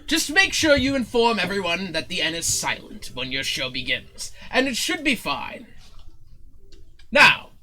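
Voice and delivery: theatrical, self-important pronouncing; haughty showman. Acted. Showman telling Zook the N is silent, haughty. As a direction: lay down terms grandly. Zin dictates terms.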